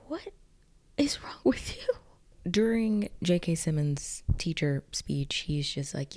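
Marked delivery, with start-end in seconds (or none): 0.1-1.9 high-pitched, halting, aghast question; mock appalled. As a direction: imitate a horrified friend scolding. Acted appalled friend confronts laughing girl.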